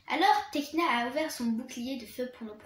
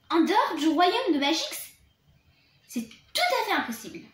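higher and much louder, indignant; shocked disbelief; 4 semitones higher, 8 dB louder than usual. Acted. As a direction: scoff as if it can't be true. Griselda disbelieving. (Griselda refuse de croire Bloom, stupéfaite et indignée)

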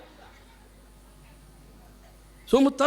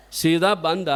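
ordinary talk

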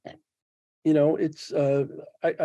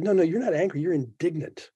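quoting a friend; 6 semitones higher than usual. Retold or imitated. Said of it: "He said".

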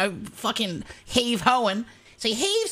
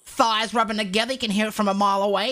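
ordinary talk

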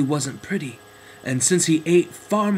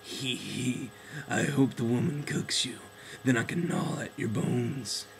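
sly cat voice; 3 semitones lower, 7 dB quieter than usual. Acted. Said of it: "the cat chirped in".

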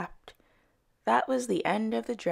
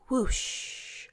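whoosh sound effect; 6 semitones higher than usual. A narrator's reading. Dragonfly swoops down.

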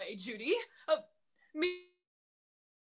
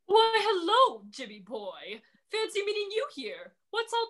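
oily feigned delight; sly greeting; 5 semitones higher than usual. Acted. Dragon greets the children with sly, oily delight.

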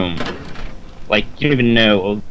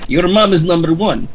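high, excitable mock Kazakh accent; comic foreign accent, pitched well up; Borat; 7 semitones higher, 4 dB louder than usual. Friend doing a film character, high mock Kazakh accent. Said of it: "He's Borat".

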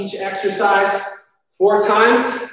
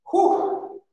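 sharp high exhale of effort; tired whew; 12 semitones higher, 5 dB quieter than usual. Real, not acted. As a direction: blow out a whew after a set. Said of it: "Feeling that one".

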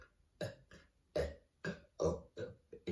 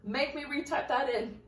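mock warning; 5 semitones higher, 6 dB louder, slower than usual. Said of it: "I was like".

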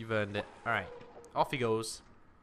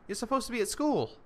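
high, exasperated telling-off; exasperated scolding; 7 semitones higher, 4 dB louder than usual. Pretend. Player to a Sim who should be at school, exasperated scolding. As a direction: scold in a raised, incredulous pitch.

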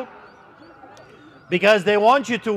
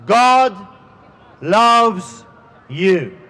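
each word set apart, pausing between; slow emphatic God loves you; 3 semitones lower, much slower than usual. Acted. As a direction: hammer home God, loves, you, with pauses. Preacher stressing final message.

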